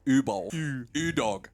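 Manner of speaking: ordinary talk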